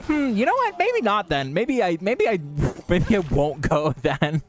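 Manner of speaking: retold sudden change of mind, pitched high; high-pitched backpedal. Streamer retelling her reply about the festival, high-pitched backpedal. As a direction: back out in a high, put-on voice.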